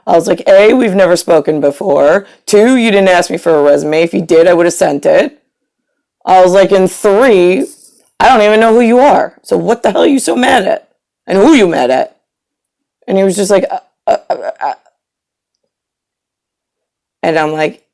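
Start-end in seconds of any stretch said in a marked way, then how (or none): 0.5-12.2 loud, point-by-point retort; retold confrontation, pushing back hard at a rude caller; indignant telling-off. 13.7-14.7 imitating someone lost for words; broken, halting stutter of syllables; mock the caller fumbling for an answer; flustered stammer.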